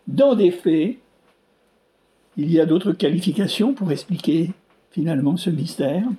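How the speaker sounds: ordinary delivery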